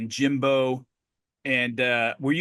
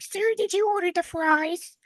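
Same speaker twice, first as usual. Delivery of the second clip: much higher squeaky little voice; squeaky kitty voice. Retold or imitated. Mimicking the small waitress's question in a squeaky kitty voice. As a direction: squeak it out like a tiny kitten. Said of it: "that's, like, my kitty voice".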